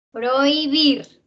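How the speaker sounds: "Prohibir" slow and loud, oi in one beat; vowels kept together. Shown as a demonstration. Teacher showing vowels that stay joined, "Prohibir" slow and emphatic. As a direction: stretch "Prohibir" with oi unbroken. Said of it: "aquí no se separan".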